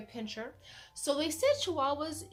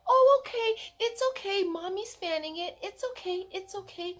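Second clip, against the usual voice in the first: soothing; 9 semitones higher, 4 dB louder than usual. Retold or imitated. Soothing scared puppy.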